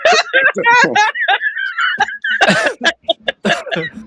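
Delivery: high, squealing fit of laughter; can't stop laughing; hysterical laughter. Real, not acted. Laughing hard at joke.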